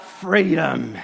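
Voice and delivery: drawn-out, low-pitched imitation of a dying cry; half-hearted, held-back film hero's scream; mock scream. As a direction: cry it out without real volume. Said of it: "screams out".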